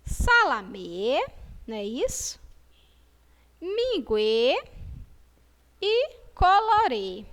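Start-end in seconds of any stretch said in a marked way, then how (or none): 0.1-1.3 SALAMÊ slow, last Ê held long and rising; long closed Ê in Salamê; drawn-out model word. Shown as a demonstration. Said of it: "vamos identificar junto com a tia". 3.6-7.2 MINGUÊ and COLORÊ louder, final Ê stretched; long closed Ê on each word; drawn-out model words. Shown as a demonstration. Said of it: "vamos identificar junto com a tia".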